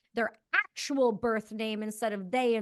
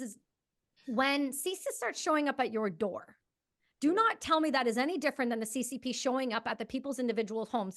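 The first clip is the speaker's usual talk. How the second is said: normal talking voice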